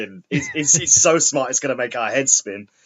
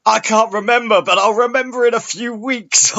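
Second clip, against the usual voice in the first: higher, louder mock Lorne voice; imitating Lorne; 10 semitones higher, 4 dB louder than usual. Podcaster mimics Lorne's excuse, higher mock voice. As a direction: ham up a dim, muddled caller.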